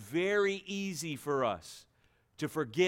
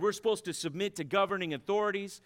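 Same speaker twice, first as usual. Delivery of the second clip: ordinary talk, nothing marked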